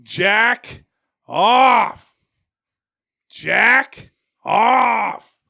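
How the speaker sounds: high, loud yell at a dog; re-enacted backyard dog-training yell. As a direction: shout two separate commands, each drawn out. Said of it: "I would be yelling".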